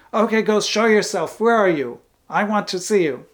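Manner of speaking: acted-out fearless summons to a ghost; bold challenge. Acting out teenager challenging the ghost.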